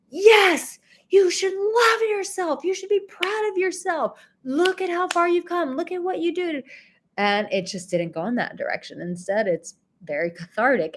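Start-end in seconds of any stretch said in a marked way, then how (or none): 0.1-6.6 much higher, bubbly pep-rally cheer; cheerleader. Pretend. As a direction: pump them up like a cheerleader. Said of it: "very strong and like a cheerleader".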